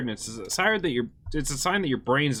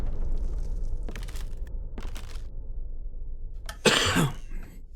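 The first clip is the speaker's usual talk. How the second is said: dry unvoiced coughing fit; 4 semitones higher than usual. Real, not acted. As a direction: cough hard a few times. Coughing after a scare.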